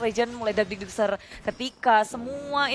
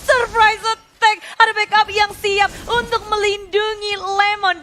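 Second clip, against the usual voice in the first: slow, drawn-out, higher hype call; hyped callout; 7 semitones higher, 5 dB louder, slower than usual. Acted. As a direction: stretch it out like an arena announcer. Caster hypes a sudden play.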